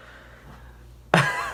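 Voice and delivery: breathy mocking laugh. Real, not acted. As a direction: laugh it off in disbelief. Laughs at the story.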